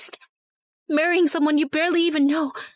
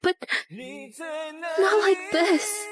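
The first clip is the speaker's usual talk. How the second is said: tearful plea; slower than usual. Acted. Crying apology.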